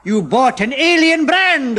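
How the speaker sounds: loud, slow, high scolding; rebuking chemist. A narrator's reading. Chemist rebuking Uncle Roger, loud and slow. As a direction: tell him off sternly. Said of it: "the chemist rebuked him".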